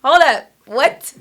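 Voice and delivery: loud, slow, incredulous; stunned disbelief. Retold or imitated. Woman hearing God's command, shocked and loud. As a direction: stop short in shock.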